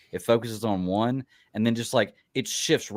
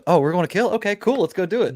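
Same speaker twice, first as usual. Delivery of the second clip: high, louder, breezily keen imitation; mock eagerness; 11 semitones higher, 7 dB louder than usual. Imitating Vigilante up for a kill, eagerly. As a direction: pipe up high, as if game for anything.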